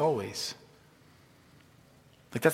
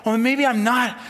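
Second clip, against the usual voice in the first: high, loud spiralling worry; mock panicked doubt; 10 semitones higher, 6 dB louder than usual. Preacher voicing a stressed believer's doubts, high mock panic. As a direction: act out a worrier working into a panic.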